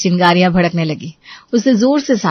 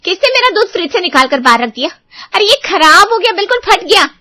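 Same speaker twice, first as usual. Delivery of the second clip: high and loud cry of outrage; woman shouts finding her milk spoiled; shrieking outrage; 10 semitones higher, 6 dB louder than usual. Acted. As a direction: yell in shock over the spoiled milk. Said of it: "तो वो चिल्ला उठी".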